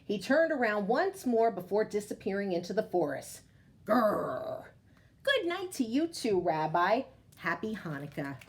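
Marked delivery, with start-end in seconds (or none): 3.9-4.6 distant bear call. Acted. Bear's last call from the forest.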